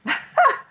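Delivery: quick, high burst of laughter; short laugh. Real, not acted. Lecturer amused by her own client impression, short high laugh. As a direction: let out one brief high laugh.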